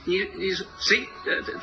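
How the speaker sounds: normal talking voice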